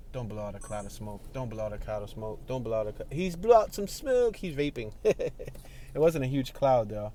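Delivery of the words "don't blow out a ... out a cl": softer, muttered plea to the vaper; hushed plea. Real, not acted. Driver quietly begging a vaping man. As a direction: ask him under your breath not to blow smoke.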